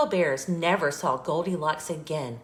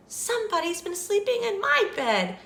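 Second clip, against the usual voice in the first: very high, sweet exclaiming; Mama Bear's sweet voice; 14 semitones higher, faster than usual. A narrator's reading. Mama Bear sees messed-up covers, sweet high voice. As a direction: exclaim sweetly, pitched well up. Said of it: "in her sweet, low voice".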